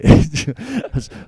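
a loud burst of real laughter. Real, not acted. Old veteran laughing after his fight story, a loud laugh.

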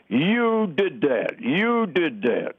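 slow, drawn-out accusing words; retold accusation. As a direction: stretch each word of the accusation out. Retelling a bystander's accusation over slaughtered cattle.

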